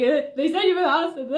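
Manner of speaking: high, loud frantic blurting; worked-up imitation of babbling at mom; retold spewing. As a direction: act out words spilling out in panic. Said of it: "I'm, like, spewing".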